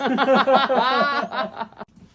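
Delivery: high bright laugh. Real, not acted. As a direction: burst out laughing with relief. Relief after messy lift.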